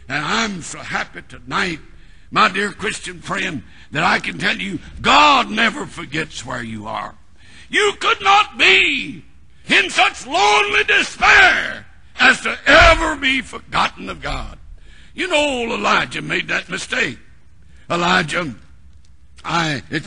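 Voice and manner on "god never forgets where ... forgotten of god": preaching at full force. Acted. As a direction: preach it out over the congregation.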